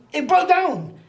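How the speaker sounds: high, loud exclamation; indignant protest. Retold or imitated. Passenger told off over the stopped train, loud indignant protest. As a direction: protest loudly at being blamed.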